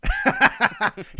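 loud high laughing cackle. Real, not acted. Host laughs at his co-host's quitting joke, a loud high laugh.

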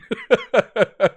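high loud burst of laughter; loud laugh at Bosa; real laugh. Real, not acted.

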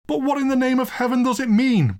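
high, exasperated demand; lawyer's outburst. Acted. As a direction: burst out in bewildered indignation. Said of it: "broke out the lawyer".

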